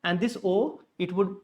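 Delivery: normal talking voice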